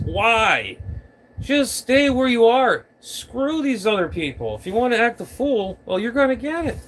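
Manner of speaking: high-pitched, insistent scolding; real indignation, pitched well up; exasperated. Real, not acted. Exasperated rebuke of radio operators.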